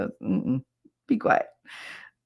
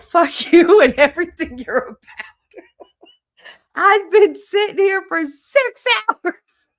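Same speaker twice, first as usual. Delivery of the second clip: loud, high, hammed-up rant breaking into laughter; imitated outrage; 12 semitones higher, 7 dB louder than usual. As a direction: mimic his furious complaint while cracking up. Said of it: "He was so mad".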